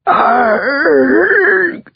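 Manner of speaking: very high scream of agony; agonized scream. Acted. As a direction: scream as the blade cuts. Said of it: "approximation of the sound Marcosa made".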